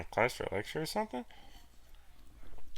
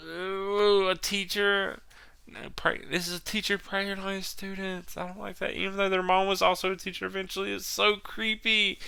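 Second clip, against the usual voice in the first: creeped out; 10 semitones higher than usual. Real, not acted. Continued disgust at teacher plot.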